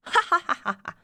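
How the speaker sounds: performed string of ha-ha laughs; acted burst of laughter. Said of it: "Fiona broke out into laughter".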